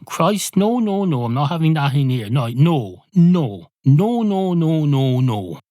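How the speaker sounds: high-pitched string of protesting refusals; exasperated refusal. Acted. Barman refuses the cat's order.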